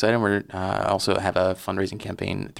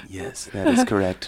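NPR voice; 13 semitones higher than usual. Pretend. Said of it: "N P R sounding interview".

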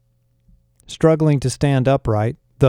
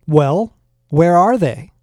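slow, higher-pitched demand; exasperated raised voice, words stretched; impatient yell; 5 semitones higher, 4 dB louder, slower than usual. A narrator's reading. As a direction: demand an answer, patience running out. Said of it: "I yelled, losing my patience".

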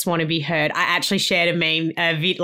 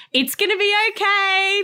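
high, sing-song reassurance to a crying newborn; lightly mock high comforting coo; high soothing self-talk. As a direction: coo it high like calming a baby. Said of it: "being like".

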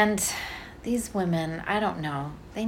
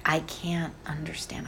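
whispered, baffled; whispered aside; 9 dB quieter than usual. Retold or imitated. Husband whispers he can't understand the nurse. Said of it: "my poor husband was like".

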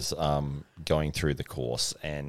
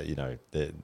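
normal talking voice

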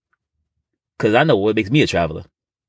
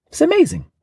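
squeaky delighted; high amazed exclamation. Acted. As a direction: squeal it with wonder.

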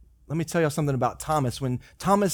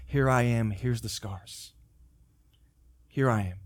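soft, slow, lower offer of self; Jesus gently showing Thomas his scars, soft and slow; tender Jesus; 4 semitones lower, 4 dB quieter, slower than usual. Retold or imitated. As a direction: gently hold out your hands.

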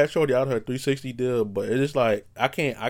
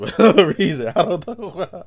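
laughing; 8 semitones higher, 8 dB louder than usual. Real, not acted. Laughs at own dream.

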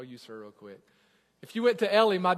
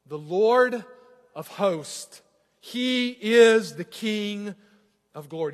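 scripture reading; 2 semitones higher, 7 dB louder than usual. Acted. Pastor reading the psalm to his church, proclaiming. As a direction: read it out like a herald.

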